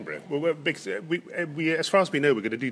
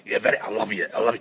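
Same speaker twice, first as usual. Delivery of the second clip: mock Glaswegian; 4 semitones lower, 4 dB louder than usual. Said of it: "anything said in Glaswegian is immensely threatening".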